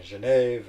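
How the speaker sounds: "Genève" said the French way, zhuh-NEV; French pronunciation. Shown as a demonstration. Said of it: "if I'm speaking French".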